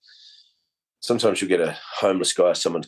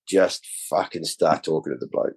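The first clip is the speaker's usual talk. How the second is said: ordinary talk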